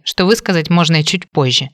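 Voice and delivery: ordinary delivery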